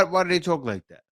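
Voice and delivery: ordinary talk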